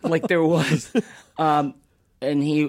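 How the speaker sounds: ordinary talk, nothing marked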